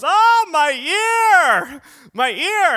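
high, loud, drawn-out mock wail; hammed-up pained yelling; screeching little girl yell. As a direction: wail like a big man reduced to a shrieking girl. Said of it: "with this screeching little girl yell".

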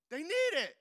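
preacher's rising insistence; emphatic outburst. Real, not acted. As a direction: cry it out to the crowd. Preacher insists, wig for girls.

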